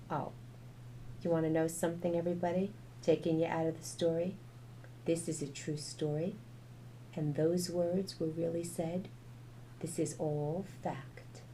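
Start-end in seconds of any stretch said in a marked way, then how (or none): none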